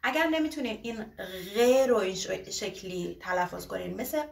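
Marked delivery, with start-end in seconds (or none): none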